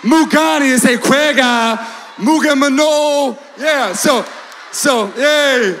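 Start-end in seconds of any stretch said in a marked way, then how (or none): none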